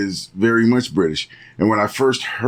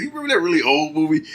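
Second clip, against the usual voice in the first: Tom Holland; 8 semitones higher, faster than usual. Retold or imitated. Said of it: "his accent was so on point".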